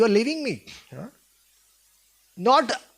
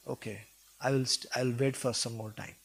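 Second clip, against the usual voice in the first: gentle consoling sage; 8 semitones lower, 6 dB quieter than usual. Retold or imitated. Sage consoling his pleading wife.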